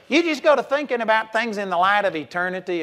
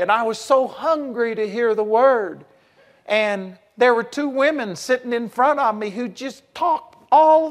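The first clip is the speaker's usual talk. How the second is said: higher, louder, distressed whine; imitating an upset student; 5 semitones higher, 3 dB louder than usual. Imitating the weeping student complaining about talking women.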